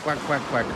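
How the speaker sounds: soft, playful quack-quack to a duck. Retold or imitated. Filming a duck, the speaker quacks at it softly. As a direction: lightly mimic a duck's quack.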